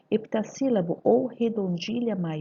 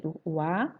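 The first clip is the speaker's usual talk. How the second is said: usual speaking voice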